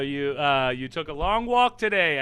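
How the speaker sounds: usual speaking voice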